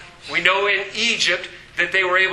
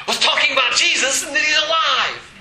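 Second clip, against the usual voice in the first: louder and higher, laughing shout; shouted preaching; 8 semitones higher, 4 dB louder than usual. Shown as a demonstration. Preacher shouts to the congregation that Jesus is alive, joyful. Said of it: "And what gets you in trouble".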